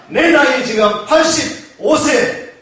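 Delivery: ordinary talk, nothing marked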